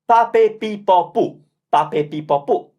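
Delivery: P with clean open vowels, twice over; Italian syllables. Shown as a demonstration. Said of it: "the five options for P".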